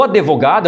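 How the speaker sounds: "advogado" as a-de-vo-GA-do; pronúncia errada de advogado. Shown as a demonstration. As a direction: slip an e after the d in "advogado". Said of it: "falou advogado". ("advogado" com e a mais, adevogado)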